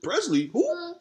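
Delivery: high, sing-song fake confusion; mock feigned ignorance. Copycat pretending not to know the original creator, high innocent voice. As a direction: play dumb like you never heard the name. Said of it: "act like".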